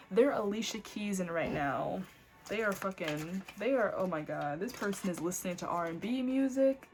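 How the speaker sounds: normal talking voice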